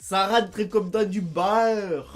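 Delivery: beurre dit « baeur », phrase lente; EU diphtongué. Shown as a demonstration. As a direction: ouvrir beurre par un A, en traînant. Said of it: "il faut penser au A". (« beurre » à la québécoise)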